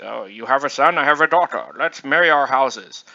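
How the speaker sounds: hammed-up period-drama matchmaker; Game of Thrones noble. Noble proposes marrying two houses, hammed-up lordly voice. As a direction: propose an alliance like a fantasy lord. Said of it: "freaking Game of Thrones".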